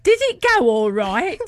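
put-on broad northern Lincolnshire accent; crazy Lincolnshire accent. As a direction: ask it in a comically thick Lincolnshire accent. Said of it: "in this sort of crazy northern Lincolnshire accent".

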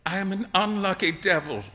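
mildly glum grumble; growled complaint. A narrator's reading. Young man curses his luck, mildly grumbling. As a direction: grumble with understated self-pity. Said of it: "growled Hughie".

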